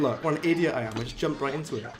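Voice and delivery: ordinary talk, nothing marked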